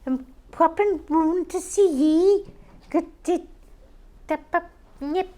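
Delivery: like a constipated chicken, high and loud; silly high impression of a friend arriving; imitating Gillian B. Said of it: "She'd go".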